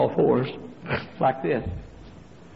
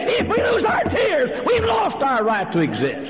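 very high, shouted pulpit cry; full-force preaching. Real, not acted. Preacher challenging fundamentalists to weep, impassioned shouting.

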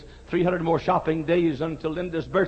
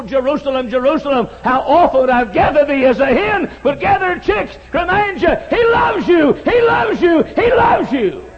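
mounting from loud to high, loud cries; building preaching shout; 6 semitones higher, 11 dB louder than usual. Acted. Preacher driving home God's love to congregation, climactic shout. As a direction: build each line louder to the climax.

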